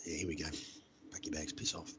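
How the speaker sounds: low, soft inner grumble; dropped, defeated aside; resigned muttering. Retold or imitated. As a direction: mutter it to yourself, expecting the worst. His resigned inner reaction, expecting eviction.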